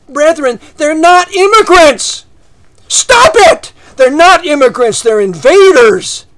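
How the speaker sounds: angry, high-pitched pulpit shouting; loud, emphatic preacher's tirade, peaking mid-way; yelling. Real, not acted. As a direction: shout down the congregation in protest. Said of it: "You don't like me raising my voice".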